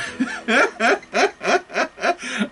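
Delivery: long high laughter. Real, not acted. Laughing at scammers losing data, high laughter. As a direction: crack up at the story.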